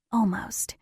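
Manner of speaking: a bare breathy whisper of the word; whispered echo. Acted. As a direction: let "Almost." fall out as a stunned whisper. Echoing the doctor's word, whispered.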